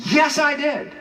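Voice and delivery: a mock heroic Adam taking the blame; noble Adam. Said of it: "That's what he should have said".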